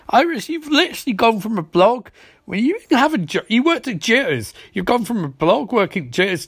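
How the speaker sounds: high-pitched incredulous scolding; comic exasperated telling-off; exasperated rant. Acted. Exasperated rant addressed to character.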